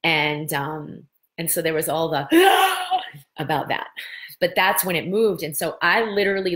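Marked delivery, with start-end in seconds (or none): 2.3-3.3 sudden high gasp of shock; sharp gasp. Real, not acted. Recalling the accident, a sharp gasp. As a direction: catch the breath in a sharp gasp.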